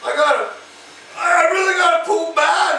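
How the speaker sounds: imitating a desperate passenger; urgent plea. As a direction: plead for a bathroom break. Voicing wife's urgent bathroom plea.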